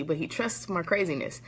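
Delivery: ordinary talk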